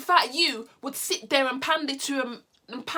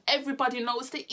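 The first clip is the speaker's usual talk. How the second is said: ordinary talk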